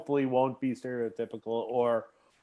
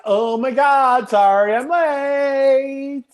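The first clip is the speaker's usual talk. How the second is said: put-on high, slow, drawn-out valley girl; mock valley girl apology; 8 semitones higher, 6 dB louder, much slower than usual. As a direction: whine it like a Bay Area girl.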